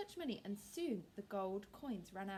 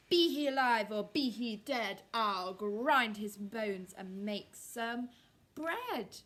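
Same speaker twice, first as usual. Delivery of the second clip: loud, slow giant rumble; booming giant threat; 9 dB louder, slower than usual. Acted.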